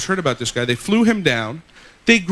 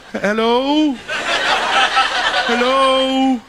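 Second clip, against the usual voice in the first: fingerless man on phone; 6 semitones higher, much slower than usual. Acted.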